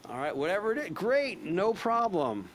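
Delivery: role-play a keen salesperson brushing off a no; upbeat sales pitch. Shown as a demonstration. Trainer role-plays upbeat sales call.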